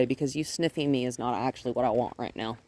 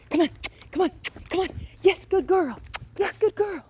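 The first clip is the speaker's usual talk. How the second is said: high urging praise; 14 semitones higher than usual. Real, not acted. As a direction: cheer her on.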